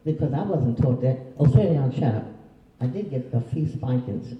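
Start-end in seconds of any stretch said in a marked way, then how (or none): 1.4-2.2 louder mock scold, snapping at a child; harsh scolding. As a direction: bark it out like a fed-up teacher. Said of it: "Don't use that".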